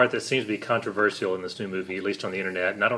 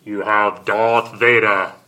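loud, slow and drawn-out villain voice; badly faked Vader, big and slow; bad impression of a looming villain; Darth Vader; 9 dB louder, much slower than usual. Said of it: "terrible impression of both of them".

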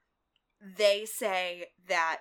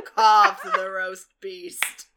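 dramatic flourish; 11 dB louder, much slower than usual. Pretend.

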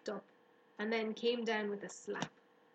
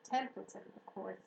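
ordinary delivery

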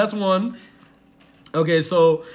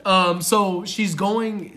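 normal talking voice

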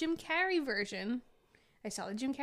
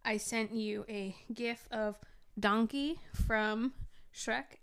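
ordinary delivery

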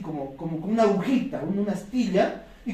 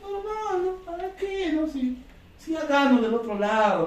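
comfortable indifference; 7 semitones higher than usual. Retold or imitated. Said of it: "yo venía, pues, al negocio como".

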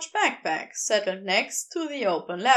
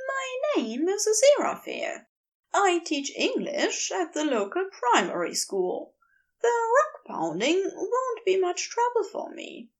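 higher, heated fussy angel voice; Aziraphale, wild; 9 semitones higher than usual. A narrator's reading. Angel ranting at the geologist, high and heated. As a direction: raise the pitch and let him rant.

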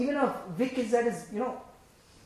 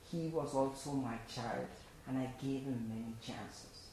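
slow, softer voice of a grieving God; sorrowful God; 8 semitones lower, 12 dB quieter, slower than usual. Acted. God grieving for a lost child, slow and soft. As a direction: let it sink, slow and hushed with sorrow.

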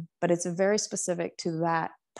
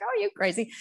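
pitched way up, incredulous scoff; 13 semitones higher, much faster than usual. Retold or imitated. Photographer brushes off her worries, incredulous and high. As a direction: toss back a high disbelieving are you crazy.

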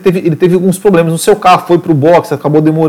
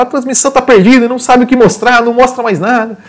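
higher, lively race commentator; Galvão Bueno; 7 semitones higher than usual. Retold or imitated. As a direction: play the excited TV broadcaster. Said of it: "o Galvão até fala".

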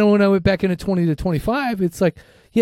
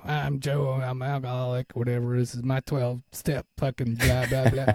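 put-on low, mumbled Minnesota accent, much softer; 7 semitones lower, 9 dB quieter than usual. Teen mocking the guy sharing at his first meeting, in a mumbled Minnesota accent. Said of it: "Is that a Minnesota accent?".

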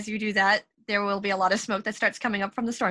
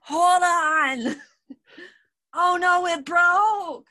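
higher, louder, dismayed ninja pup; upset pup voice; 7 semitones higher, 5 dB louder, much slower than usual. Acted. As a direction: cry out that it broke.

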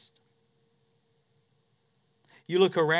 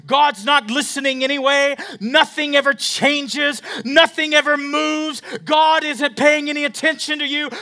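Satan's taunts; 9 semitones higher, 6 dB louder than usual. Retold or imitated. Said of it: "what Satan will tell you".

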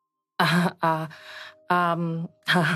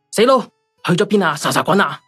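loud, irritated telling-off; cross scolding; 8 dB louder than usual. Acted. Wife phoning her missing husband, cross.

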